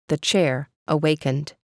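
ordinary delivery

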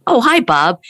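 mock friendly hello; eager clerk.

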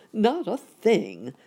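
sadly. A narrator's reading. Uncle helpless as they fall, slow mild lament. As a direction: drag it out in mock sorrow. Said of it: "said Uncle Wiggily sadly".

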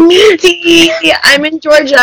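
high, squealed teasing imitation; mocking imitation. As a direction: tease in a high, silly voice. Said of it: "What you're not about to do is be like".

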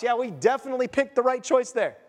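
ordinary talk, nothing marked

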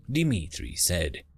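ordinary delivery

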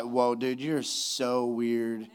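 low, soft, drawn-out mock bewilderment; weirded-out listener. Audience member reacting to the odd movie plot, slow and weirded out.

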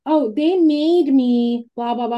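mock whiny kid voice, slow; son's excuse in a mocking tone; imitating son. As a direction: lightly mimic a whining kid. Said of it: "he will say something like".

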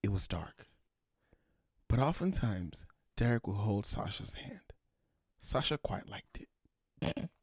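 hushed, slow bedtime narrator; soft storyteller voice. Retold or imitated. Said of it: "He should be like this".

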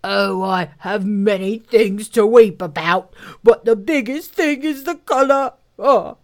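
loud lamenting rhinoceros; put-on rhino voice, working up to tears; sorrowful rhinoceros. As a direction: bemoan it as a big weepy beast.

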